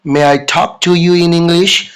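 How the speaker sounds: slow, deliberate English line; slow model sentence. Shown as a demonstration. Teacher slowly models asking to speak English. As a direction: spell it out slowly for learners. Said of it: "आप may I का जरूर प्रयोग करेंगे".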